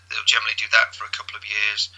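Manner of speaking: ordinary delivery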